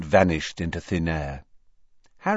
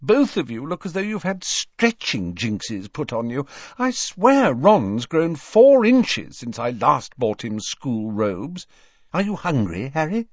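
Missus Weasley; 5 semitones higher than usual. Acted. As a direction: fuss over a grown boy like a mother. Motherly Missus Weasley fusses over Harry.

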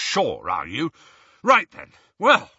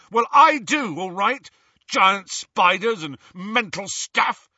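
higher, louder, bitter tirade; angry rant; 8 semitones higher, 4 dB louder than usual. Acted. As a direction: rant at him, hurt and furious. Ron's angry rant at Harry.

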